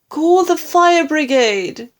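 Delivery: high, loud call for help; crying out. A narrator's reading. Wife calling for help for grandma, panicked shout. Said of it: "cried Missus Cranky".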